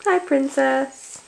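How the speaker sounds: usual speaking voice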